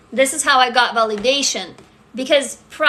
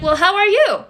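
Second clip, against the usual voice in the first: higher, louder eager question back; expectant question back; 9 semitones higher, 4 dB louder than usual. Retold or imitated. Her asking the American in return, eager higher question. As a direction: throw the question back, wanting an answer. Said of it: "I'm like".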